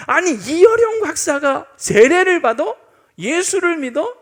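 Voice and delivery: loud, high mock disbelief, stretched out; incredulous outcry. Preacher imitating people hearing of the scholar's baptism, incredulous. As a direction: exclaim it like scandalized onlookers. Public shock at atheist's baptism.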